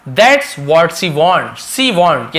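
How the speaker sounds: each word stretched apart; very slow model sentence. Shown as a demonstration. Teacher slowly reading the example sentence aloud. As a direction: lay it out slowly for students.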